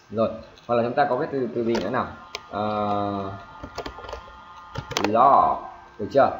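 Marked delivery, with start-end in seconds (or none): 5.0-5.6 "law" loud with a long, falling open o; full vowel length. Shown as a demonstration. Teacher modelling vowel length, "law" drawn out. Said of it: "đọc đúng cái độ dài của nguyên âm".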